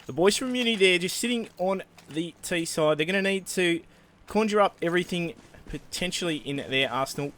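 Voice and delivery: hammed-up Aussie commentator, mostly lightly voiced; mock Australian Ocker commentary; Ocker Australian accent. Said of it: "our best Australian Ocker impression".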